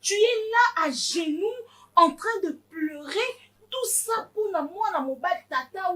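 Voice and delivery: wounded mother's tirade; outraged scolding. Acted. Mother scolding her crying daughter.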